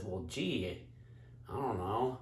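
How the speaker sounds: low, soft dopey tree voice; talking tree. Acted. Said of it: "And the tree says".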